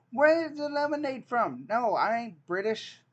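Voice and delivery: high, loud indignant outburst; indignant disbelief. Real, not acted. Reacting to a British quiz option, loud and indignant.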